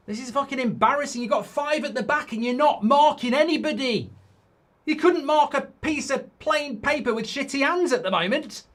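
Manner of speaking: exasperated fan ranting at his team's defending; angry rant. Real, not acted. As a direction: vent your fury at the players, voice up high.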